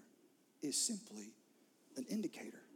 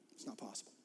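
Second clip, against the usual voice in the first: whispered; hushed conviction; 7 semitones lower, 12 dB quieter, faster than usual. Real, not acted. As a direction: drop to a hush. Whispered sermon point.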